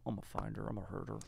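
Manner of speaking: low, hushed movie-villain menace; Philip Seymour Hoffman. Acted. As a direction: threaten softly and calmly, like a villain. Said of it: "Philip Seymour Hoffman wakes up".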